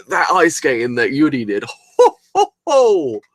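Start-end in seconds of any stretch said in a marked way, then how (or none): 2.0-3.2 squealing ho ho laugh, much higher and louder; real giddy squeal of laughter; gleeful high laugh. Real, not acted. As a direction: burst out in delighted high laughter. Laughing at the skating.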